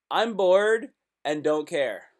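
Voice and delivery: slow mock translation. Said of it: "what they're really saying is".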